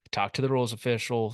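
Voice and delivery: ordinary delivery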